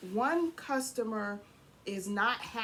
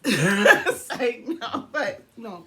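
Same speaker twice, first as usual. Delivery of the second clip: laughing; 12 dB louder, much slower than usual. Real, not acted. Laughing at own police joke.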